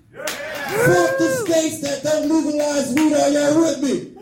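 high, slow, drawn-out rallying yell; crowd-rousing shout. Real, not acted. Comic rallying the audience about weed, slow shouted chant. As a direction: stretch it out and rouse the room.

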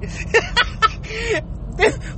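squealing, near-crying laughter; high helpless laugh. Real, not acted. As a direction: lose it completely. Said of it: "I can't even say this shit straight".

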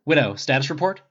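brisk. A narrator's reading. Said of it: "Rogers said briskly".

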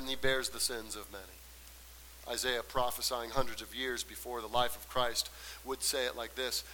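suddenly soft and low; hushed preaching. Real, not acted. Preacher introduces Isaiah's prophecy to congregation, hushed. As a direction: fall away from the climax into a low aside. Drops low after climax.

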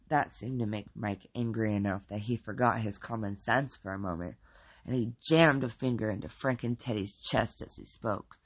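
ordinary delivery

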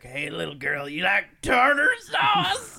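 high, drawn-out cartoonish old-man holler; Yosemite Sam-like shout, building to a yell; scary old man. Old man accosting a little girl about tartar sauce, imitated in a loud high holler. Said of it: "He sounds like a Yosemite Sam".

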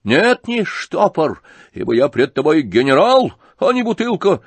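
high, loud, drawn-out rage; frenzied shouting. A narrator's reading. General demanding a decision from Птицын, frenzied shout. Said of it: "крикнул он опять в выступлении".

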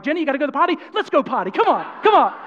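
high, hyped-up coaxing; mock-excited, as if cheering on a puppy; excited dog-training voice. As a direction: urge them on like an excited dog owner. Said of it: "it bleeds over to my family".

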